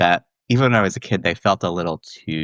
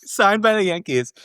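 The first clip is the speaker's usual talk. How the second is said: high, laughing confirmation; 12 semitones higher than usual. Real, not acted. Confirming the Yankees detail, laughing. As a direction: say it through the giggles.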